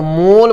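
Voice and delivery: "मोल" with a long rounded o; wrong o vowel. Shown as a demonstration. Teacher showing a mistake, "मोल" with closed o. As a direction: say "मोल" with a pure o. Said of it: "ये दोनों गड़बड़".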